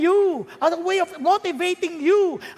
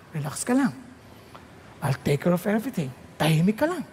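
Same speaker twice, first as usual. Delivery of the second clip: God reassuring; 9 semitones lower, 3 dB quieter than usual. Acted. God answering the preacher's prayer.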